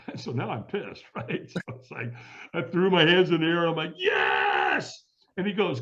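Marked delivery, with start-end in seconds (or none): 4.0-5.0 long, roaring yes; fired-up shout. Retold or imitated. Angry firewalker answering the screaming trainer, all-out roar. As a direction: shout it out with everything, angrily pumped.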